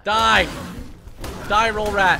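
battle yell at the rat; shouting. Real, not acted. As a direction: yell it out, going for the kill. Attacking the roll rat in game.

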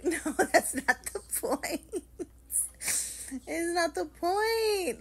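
high, loud, drawn-out protest; hammed-up pleading outburst; exasperated wail. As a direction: wail it slowly in exaggerated frustration. Retelling her frantic argument with husband.

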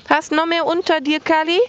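high, quick pet voice; pet talk. Acted. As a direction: ask the cat sweetly. Talking to cat.

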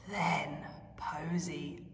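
soft whisper, slow; breathy hushed telling; whispered narration. A narrator's reading. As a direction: hush it like a secret. Storyteller whispers the fall.

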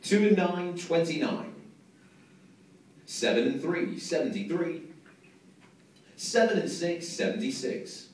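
slow, drawn-out bingo number calls; bingo caller. Acted. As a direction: call each number out, pausing between. Calling bingo numbers to hall.

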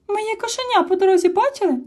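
high, eager little Sniff asking; a small anxious creature asks. A narrator's reading. (високий голосок малого)

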